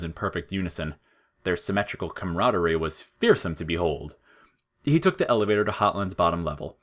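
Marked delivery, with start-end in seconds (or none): none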